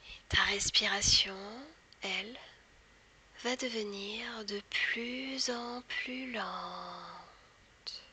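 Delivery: slow whispered guided hypnosis. Acted. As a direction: whisper slowly, guiding into trance. Leads a hypnosis induction.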